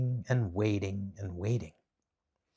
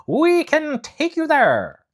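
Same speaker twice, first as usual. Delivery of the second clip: much higher, louder, slow monkey king; 8 dB louder, slower than usual. Acted. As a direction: offer grandly in a changed voice. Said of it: "the King of the Monkeys said".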